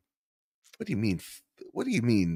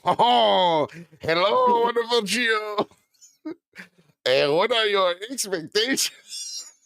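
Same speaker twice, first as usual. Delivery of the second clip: higher, hammed-up French accent that slides into Mexican; botched French accent; 4 semitones higher, slower than usual. Host reads a listener's question in a botched French accent. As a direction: try French and miss toward Mexican. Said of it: "Joe with your best French accent".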